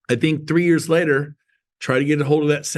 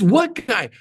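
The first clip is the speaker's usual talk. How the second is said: high-pitched puzzled callback, imitated; calling back; 7 semitones higher than usual. Coworker still doesn't know who is calling, mock puzzled callback. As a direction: mimic a coworker still not getting it. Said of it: "She muffles the phone, but I could still hear".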